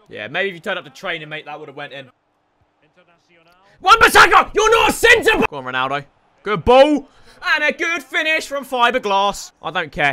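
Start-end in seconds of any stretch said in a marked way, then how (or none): 3.8-5.5 loud high yelling; yelling at his defender; angry shout. Real, not acted. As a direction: bark it at the screen.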